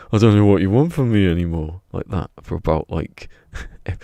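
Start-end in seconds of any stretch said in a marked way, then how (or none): 0.1-1.8 loud, higher, swooping overacted outburst; hammed-up mock anger; botched angry acting. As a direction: imitate an actor blaring the line wrong. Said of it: "but he says it like".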